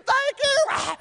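high, loud blubbered thanks; mock crying. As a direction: wail it out as if bawling. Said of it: "He was crying".

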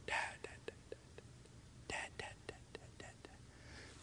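put-on tiny whispered babble of a child; barely audible, repeated whispered calls; imitated child's whisper. Father imitating his child's first words for him, whispered.

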